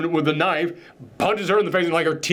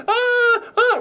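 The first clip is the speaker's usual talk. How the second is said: very high imitated shriek; short, high cartoonish screams; mock screaming. Italian woman runs around the house, high mock screams. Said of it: "she's screaming in Italian".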